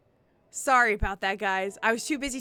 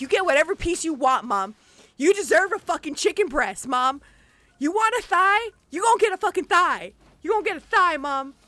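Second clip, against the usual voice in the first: much higher and louder, yelling at mom; fired-up shout; 10 semitones higher, 9 dB louder than usual. Retold or imitated. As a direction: hype her up at full volume.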